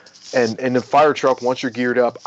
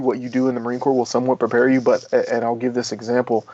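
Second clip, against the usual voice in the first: normal talking voice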